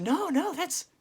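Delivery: very high, urgent protest; squeaky hammed-up interruption; acted agitation. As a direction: burst in high and flustered. Acts Peter answering in the panic.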